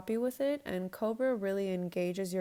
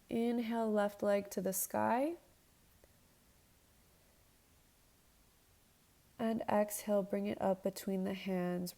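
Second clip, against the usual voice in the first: yoga instructor cueing. Acted. Leads a yoga flow.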